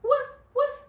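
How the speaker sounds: high, loud playful barking; dog bark. Acted. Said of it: "barked the dog".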